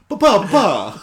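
high, loud mock Italian accent, half laughing. Shown as a demonstration. Podcaster repeating the slap noise as asked, mock Italian voice. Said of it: "say it in an Italian voice".

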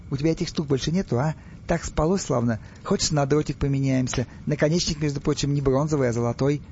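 ordinary delivery

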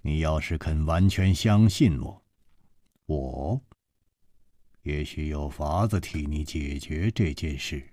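low, soft, reassuring man's voice; gentle voice. Acted. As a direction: soothe her, speak low and kind. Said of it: "楚留香柔声说".

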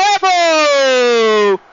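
drawn-out goal scream, high and slow; long goal yell. Real, not acted. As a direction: stretch GOL out as long as you can. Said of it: "excelente narração".

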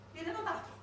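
ordinary talk, nothing marked

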